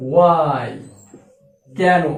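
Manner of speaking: ordinary talk, nothing marked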